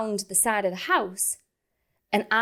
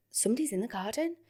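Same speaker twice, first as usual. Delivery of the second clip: softer, questioning inner voice; hushed alarmed thought; 3 semitones higher, 7 dB quieter, faster than usual. Retold or imitated. Woman hearing noises outside at bedtime, hushed alarmed thought. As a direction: think it aloud, uneasily.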